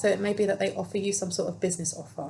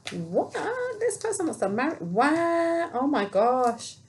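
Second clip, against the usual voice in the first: high, swooping disbelief, drawn out at the end; astonished; 8 semitones higher, 3 dB louder, slower than usual. Real, not acted. Reader learning this person wants to marry you, astonished. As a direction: react amazed, voice leaping up high. Amazed by the marriage message.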